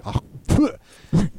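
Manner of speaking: quick oh and a puffed spit; imitated spit. The other two diners tasting it, imitated spit. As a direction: mimic another taster spitting it out.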